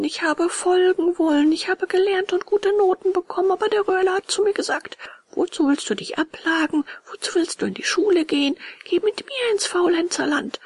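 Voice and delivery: high-pitched, tearful self-defence; whining puppet. Acted. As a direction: pour out excuses in a high little voice. Puppet tearfully justifies himself. (weinerlicher Hampelmann, hohe Stimme)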